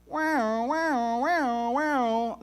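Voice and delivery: imitated alarm siren. Preacher imitating the alarm siren for the congregation. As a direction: mimic the alarm going off. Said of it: "a voice out of nowhere".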